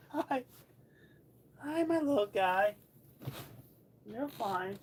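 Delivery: high, slow, tender cooing; cooing hello to a pet. Real, not acted. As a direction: soothe the cat gently. Greeting the cat.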